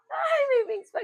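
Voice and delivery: high surprised "Ay, may wings pa". Real, not acted. Finds more wings.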